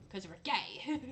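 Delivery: slow, giggling joke; laughing. Real, not acted. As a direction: say it while laughing.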